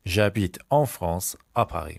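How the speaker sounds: J'habite en France à Paris said slowly, every word clear; slow model sentence. Shown as a demonstration. Teacher to students, slow clear model. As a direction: spell it out slowly for the learners. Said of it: "teacher repeats slowly for class".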